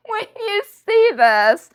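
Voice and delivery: breaking into a high laugh mid-sentence; gleeful, laughing through the words; laughing excitement. Real, not acted. Vlogger teasing viewers about a great find, laughing.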